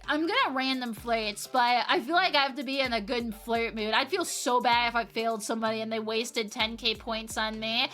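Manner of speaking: usual speaking voice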